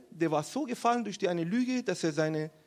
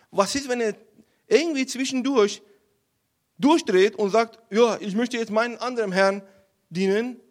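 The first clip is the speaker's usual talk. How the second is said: loud, raised, urgent remonstrating; agitated protest; 4 semitones higher, 8 dB louder than usual. Acted. General warning the king about David, loud agitated protest. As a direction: argue it hard, as an alarmed general.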